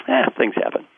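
high-pitched, offhand shrug; lightly acted brush-off; mock unbothered. Wet monk brushes off the scolding.

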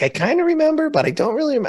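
high-pitched mock vague recollection; mimicked mom. Mom asked about that day, high mimicked vagueness. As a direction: imitate mom way up high, unsure. Imitating mom's vague memory.

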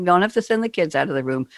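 ordinary talk

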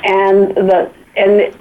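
ordinary delivery

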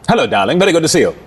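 affected British accent, climbing in pitch as it goes; lilting, theatrical British accent; suave British character. Shown as a demonstration. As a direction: play a charming British gentleman greeting his darling. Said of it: "that's the dialogue that I was creating".

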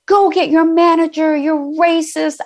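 mocking the drunk customer. Drunk customer shouting at the waitress for her manager, high mock outrage. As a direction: squeal it like an offended drunk demanding a manager. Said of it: "he's like".

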